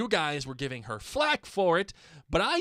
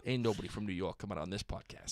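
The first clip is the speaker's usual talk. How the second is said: mock New York accent; 7 semitones lower, 8 dB quieter, faster than usual. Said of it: "Can you imagine a New York accent?".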